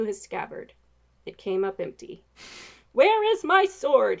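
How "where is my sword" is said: loud, high knight's shout. Acted. Knight shouting over the wind. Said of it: "he shouted over the roar of the wind".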